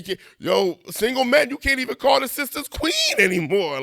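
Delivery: usual speaking voice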